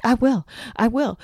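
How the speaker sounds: mock defiant shout. Candace daring Nilsa, imitated loud threat. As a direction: dare her right back.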